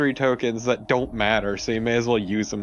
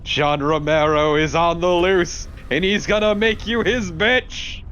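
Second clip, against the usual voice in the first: loud, higher mock boss-intro call; hype announcer shout; 6 semitones higher, 4 dB louder than usual. Co-player hyping the final boss arrival to friends, loud mock announcer. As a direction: bellow it like a wrestling announcer hyping the villain.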